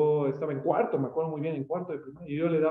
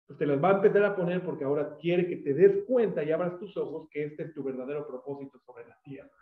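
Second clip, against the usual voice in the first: normal talking voice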